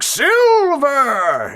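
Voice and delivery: long high yell of silver; excited prospector shout. Retold or imitated. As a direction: shout it like striking it rich. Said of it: "then he's like".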